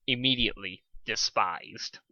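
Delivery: usual speaking voice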